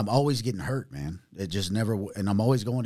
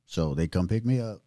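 usual speaking voice

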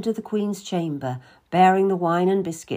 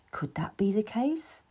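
whispered thought; 7 dB quieter than usual. A narrator's reading. Narrator's private doubting thought.

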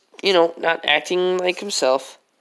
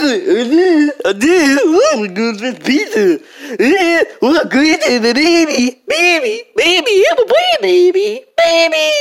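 loud high silly brat; bratty kid voice; 7 semitones higher, 4 dB louder than usual. Acted.

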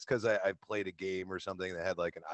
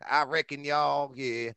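slow, high, louder Southern drawl; 7 semitones higher, 4 dB louder, slower than usual. Shown as a demonstration. Guest jokingly tries Southern accent, slow high drawl. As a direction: stretch it out like a Southern cowboy. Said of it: "like, foreign accents".

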